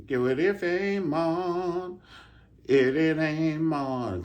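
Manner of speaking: high, slow, shaky mocking voice; imitating the nervous usher; mock twitchy usher. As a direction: mimic a jittery man.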